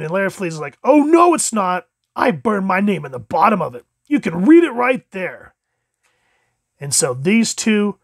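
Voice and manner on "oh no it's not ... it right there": high, loud comic protest; indignant retort. Acted. Larfleeze disputing Atrocitus's claim to the lantern, indignant retort. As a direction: argue back, pitch leaping at start and end. Acting Larfleeze arguing back.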